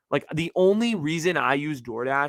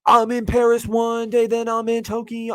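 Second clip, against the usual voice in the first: mock jet-setter voice; 8 semitones higher, 4 dB louder than usual. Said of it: "They're like".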